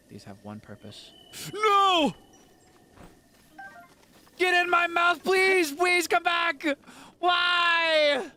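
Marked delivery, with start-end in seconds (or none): 1.5-8.3 much higher, shouting despair; melodramatic anguish. Acted. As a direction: wail like your heart just broke. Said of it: "I just got too invested in the character".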